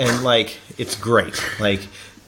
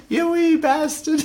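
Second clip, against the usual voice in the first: high, drawn-out squeaky British voice; hammed-up British falsetto; mock British insult; 12 semitones higher than usual. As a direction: toss the insult out in a piping British accent. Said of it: "should we do it in a British accent?".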